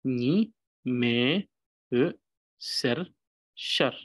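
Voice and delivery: clear, one syllable at a time; syllable reading. Shown as a demonstration. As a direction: pause between each syllable. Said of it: "Making a little pause between each syllable".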